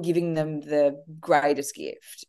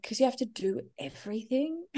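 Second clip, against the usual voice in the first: put-on higher and softer, like a small boy; soft child's voice; 8 semitones higher, 8 dB quieter than usual. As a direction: explain it earnestly as a sleepy kid. Oscar explaining in bed.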